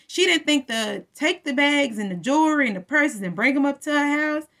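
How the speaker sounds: normal talking voice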